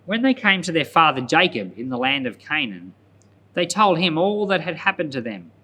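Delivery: ordinary talk, nothing marked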